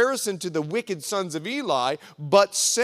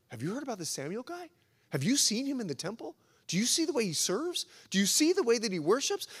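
soft, quick murmur of onlookers; hushed admiring gossip; 3 semitones higher, 5 dB quieter, faster than usual. Acted. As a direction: pass it on like an amazed rumour.

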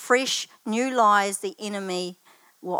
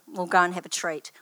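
ordinary talk, nothing marked

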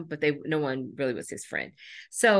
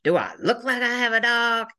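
higher, louder snarky retort; sassy boy; 7 semitones higher, 4 dB louder than usual. Retold or imitated. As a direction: throw the question back with attitude. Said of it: "So he says".

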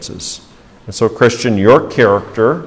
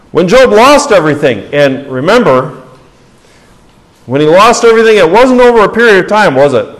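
raised, loud pulpit questions to the congregation; fervent preaching; 7 semitones higher, 6 dB louder, faster than usual. Acted. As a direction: preach it out, voice lifted high and strong. Preaching at full force.

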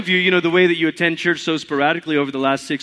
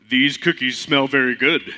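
lightly lowered Toad voice; a hint of a lower frog character; 3 semitones lower than usual. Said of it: "Toad has to have a lower voice".

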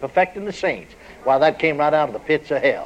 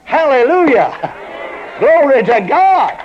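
ecstatic praise; 10 semitones higher, slower than usual. Real, not acted. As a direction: shout praise in rapture.

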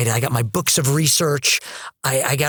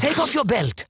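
higher, badly faked French accent; 7 semitones higher than usual. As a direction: give an order in a mock French accent. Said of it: "forgive my bad French accent".

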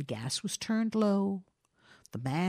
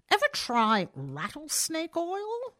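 interested question; 9 semitones higher, slower than usual. A narrator's reading. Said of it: "asked the citizen interestedly".

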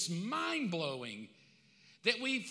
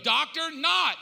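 loud, higher booming verdict; God's verdict; 7 semitones higher, 10 dB louder, slower than usual. Pretend. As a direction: thunder it down, slowly at the end. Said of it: "God looks at all of you and goes".

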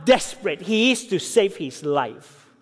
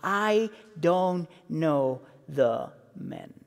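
softer, slow, drawn-out oath; sworn denial; 5 semitones lower, 6 dB quieter, much slower than usual. Retold or imitated. Peter swearing to the bystanders, softer and slow. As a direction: grind it out low and slow. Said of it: "he swore to them".

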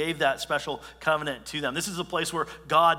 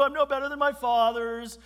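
much higher mocking whimper; mock the self-pity, higher; hammed-up whining; 9 semitones higher than usual. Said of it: "Boo-hoo".

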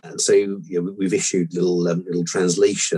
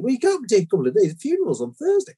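urgent complaint; 12 semitones higher, faster than usual. Retold or imitated. Customer insists funeral is Thursday.

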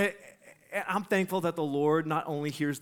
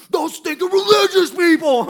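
high, very loud mock-furious yell; angry outburst; 14 semitones higher, 13 dB louder than usual. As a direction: roar in imitation of an outraged heckler. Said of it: "he's mad again".

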